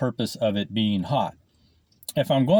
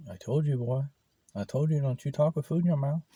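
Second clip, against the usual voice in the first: imitating mom; 5 semitones higher than usual. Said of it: "she might be watching and she'll know".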